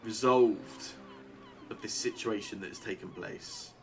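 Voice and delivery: stately town mayor, measured and lofty; lightly voiced mayor. GM voices the mayor addressing Carmen.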